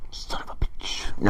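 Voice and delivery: breathy unvoiced hiss of a curse; whispered curse. Josie mutters to Fletcher, a slow whisper. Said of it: "And he's like".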